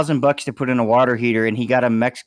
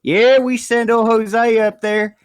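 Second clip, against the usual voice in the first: high, slow drawling boss voice; 7 semitones higher, slower than usual. Retold or imitated. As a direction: ham up a slow lazy contractor. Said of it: "He goes".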